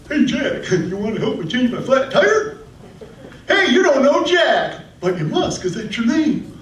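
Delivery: high put-on teasing voice; mock heckler. People ribbing a man named Jack, mocking high voice. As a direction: call out the tired joke, high and hammed-up.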